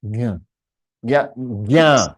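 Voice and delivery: "ज्ञ" as gya, then "ज्ञा" with long aa; demonstrating ज्ञ. Shown as a demonstration. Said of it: "आ किया".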